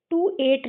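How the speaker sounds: "टू एट" with a plain T sound; wrong spelling-based reading. Shown as a demonstration. Teacher repeating for kids the wrong reading, "टू एट" with hard T. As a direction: say "टू एट" as two separate words, hard T. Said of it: "इसको क्या नहीं पढ़ते हैं".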